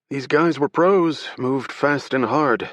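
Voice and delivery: brief the agents like a tense security director; security director recounting the robbery. Acted. Security director voiced in story.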